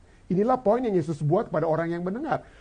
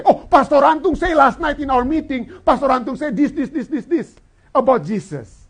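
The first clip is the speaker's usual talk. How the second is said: higher, louder second-hand reporting; imitating churchgoers; 5 semitones higher, 4 dB louder than usual. Believers parroting last night's sermon, mock eager recital. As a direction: rattle off what the pastor said. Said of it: "this is the thing that we used to say".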